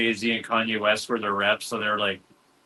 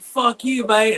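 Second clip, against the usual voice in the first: imitated drunk mate, high and loud; drunk Aussie friend; 10 semitones higher, 5 dB louder, slower than usual. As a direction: mimic a tipsy friend's indignant outburst. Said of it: "my friend's all drunk".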